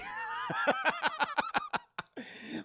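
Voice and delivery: a real, very high laugh bursting out; real laughter at confusing two names. Real, not acted. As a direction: cackle at your own mix-up. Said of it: "Is it Buddy or is it Bobby?".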